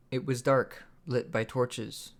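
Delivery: ordinary delivery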